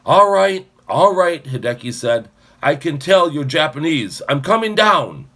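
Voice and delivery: very loud, high call down; shouting. Acted. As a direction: shout down to the bunker. Calling down to bunker.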